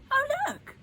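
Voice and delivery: put-on high, bright child's cry of discovery; excited cry. A narrator's reading. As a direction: call it out like a thrilled little boy. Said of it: "cried Toto".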